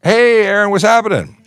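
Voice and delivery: big high-pitched greeting; excited greeting. Real, not acted. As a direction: call out a big welcome to an arriving friend. Greeting co-host who just arrived.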